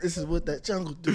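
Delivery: repeating the joke through laughter; laughing as he says it. Real, not acted. Friend repeats his joke about the club, laughing.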